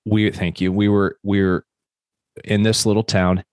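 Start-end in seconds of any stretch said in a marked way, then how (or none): none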